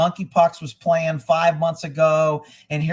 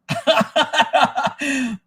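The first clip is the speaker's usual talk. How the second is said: laugh; 9 semitones higher than usual. Real, not acted. Laughing at his own remark.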